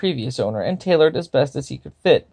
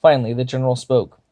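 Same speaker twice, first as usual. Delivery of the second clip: ordinary delivery, nothing marked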